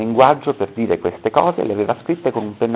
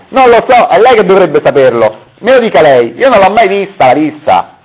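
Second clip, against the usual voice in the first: very high, loud frustrated shout; shrill loud outburst at the psychiatrist; yelling; 11 semitones higher, 12 dB louder than usual. Acted. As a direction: scream it, fed up with the question.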